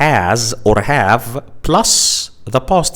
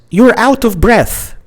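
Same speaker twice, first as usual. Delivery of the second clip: high quoted line; 9 semitones higher, 4 dB louder than usual. Shown as a demonstration. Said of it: "Speaker A says".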